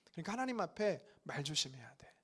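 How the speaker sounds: hushed cautionary whisper. Shown as a demonstration. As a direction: whisper "하나님 앞에 말 조심해야 돼" as a secret warning. Repeats warning in whisper. (속삭이는 경고)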